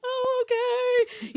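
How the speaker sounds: put-on high, slow, small child; little girl voice. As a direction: put on a tiny girl's voice. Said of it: "she's looking through the mirror and she's like".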